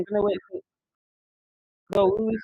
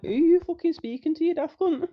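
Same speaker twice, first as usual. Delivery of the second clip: much higher and louder, mock yelling back; retold angry retort; 11 semitones higher, 4 dB louder than usual. Telling a rude player off, a high, loud retold yell. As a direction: snap back high and loud like the row is happening again.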